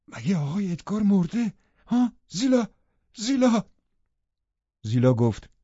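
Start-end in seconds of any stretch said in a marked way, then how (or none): 0.1-3.6 higher, anxious, calling her name; a gasp of sudden dread for her master; breathless alarm. A narrator's reading. Housekeeper hearing her master is dead, breathless and alarmed. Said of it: "نفسم گرفت و گفتم".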